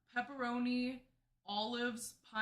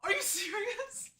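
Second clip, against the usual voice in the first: high, slow disbelief; shocked; 9 semitones higher, slower than usual. Real, not acted. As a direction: react to someone retching. Friend reacts to the retching.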